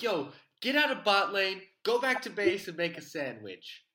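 loud, high pretend gamer rant; mock yelling. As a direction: yell it like a hammed-up raging gamer. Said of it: "I almost just yelled".

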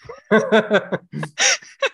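high bright burst of laughter; amused rising laugh. Real, not acted. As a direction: laugh out loud at the heckle. Laughing at the boo.

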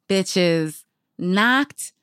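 usual speaking voice

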